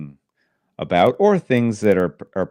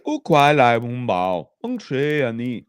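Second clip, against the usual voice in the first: mock Mandarin; 4 semitones higher, 3 dB louder than usual. Repeating the Mandarin line for a doubtful co-host, mock Mandarin. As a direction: repeat the pretend Chinese more evenly. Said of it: "And really?".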